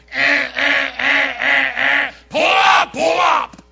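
honk-like "eh, eh" then a slow bellow of "pull up"; New York impatience. Retold or imitated. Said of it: "the New York thing".

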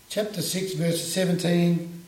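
normal talking voice

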